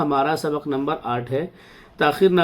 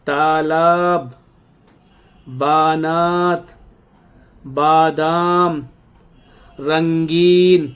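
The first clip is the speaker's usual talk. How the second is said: each word spaced and drawn out, a touch louder; slow dictation reading; 3 dB louder, much slower than usual. Acted. Urdu teacher reading vocabulary aloud to students. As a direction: read the list slowly, one word at a time, for pupils.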